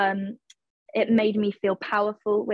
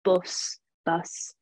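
subtle Nottingham vowel in "bus"; Nottingham accent; 2 semitones lower than usual. Shown as a demonstration. Actress shows her coached accent on one word, subtle Nottingham accent. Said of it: "just getting that accent".

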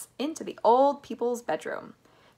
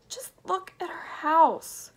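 high, slow lament; distressed cry; 10 semitones higher than usual. A narrator's reading. Mister Bucket sees his wrecked house, distressed cry. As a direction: draw it out in dismay. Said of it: "cried poor Mister Bucket".

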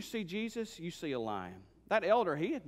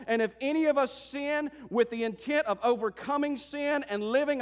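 driving, emphatic sermon delivery; rally the congregation with conviction; fervent preaching; 6 semitones higher, 4 dB louder than usual. Acted. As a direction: preach it with rising fervor.

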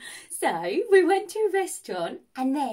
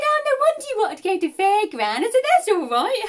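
high, louder chirpy reply; bright high reply; 9 semitones higher, 7 dB louder than usual. Retold or imitated. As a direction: beam and accept it happily. Cheerful misunderstanding to date.

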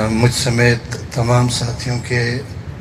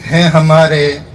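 measured mushaira recitation, line by line; poetry recitation; 4 semitones higher, 5 dB louder, slower than usual. Acted. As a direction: recite the verse in separate phrases, lingering on the refrain. Recites own poem at a gathering. (मुशायरे के अंदाज़ में शायरी)

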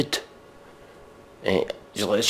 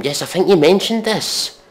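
sarcastic aside; 7 semitones higher, 4 dB louder than usual. Real, not acted.

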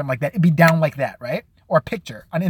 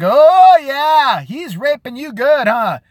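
gleeful filming bystander; 5 semitones higher, 5 dB louder, slower than usual. Retold or imitated. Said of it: "Be like".

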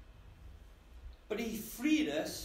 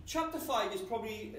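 usual speaking voice

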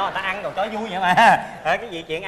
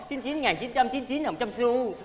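mocking slow quote; 4 semitones higher, 7 dB quieter, slower than usual. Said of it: "bà đặt bẻ miệng, bẻ mồm".

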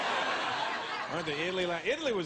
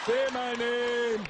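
long drawn-out, higher demand; slow, raised, pleading climax; insistent lover; 4 semitones higher, 4 dB louder, much slower than usual. Acted. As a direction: stretch it out in mock passion. Lover's demand escalates, acted.

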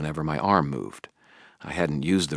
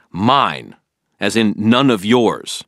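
slow, louder cutting reply; angry retort; 6 semitones higher, 9 dB louder, slower than usual. A narrator's reading. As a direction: lay down the line. Harry snapping at Billy.

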